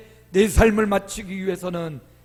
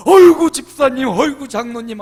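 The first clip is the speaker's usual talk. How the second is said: much higher and much louder, hammed-up joy; over-the-top greeting; 5 semitones higher, 13 dB louder than usual. Shown as a demonstration. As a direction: overdo it on purpose, big and loud. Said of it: "하면서 오버하시기 바랍니다".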